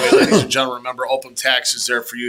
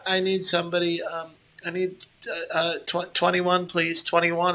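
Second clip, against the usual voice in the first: timid cashier; 3 semitones higher, 6 dB quieter than usual. Retold or imitated. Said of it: "the girl is going".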